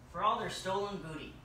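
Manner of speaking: usual speaking voice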